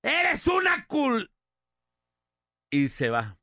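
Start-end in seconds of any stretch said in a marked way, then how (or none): 0.0-1.3 high, drawn-out shout cut off short; acted angry yell. Friend screaming at the classmate who withheld the answers, angry yell. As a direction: yell the insult slowly at full pitch. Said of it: "le grita".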